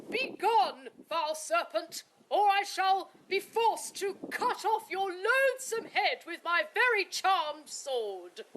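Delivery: high, loud knightly threat; heroic challenge. Acted. Third challenge to the dragon.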